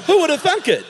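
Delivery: high, laughing mock amazement; mock surprise. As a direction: feign big surprise, laughing. Joking surprise at Bulgarian name.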